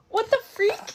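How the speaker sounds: sharp high gasp, then exclamation; real startled squeal. Real, not acted. Reacting as something lands in trash, startled.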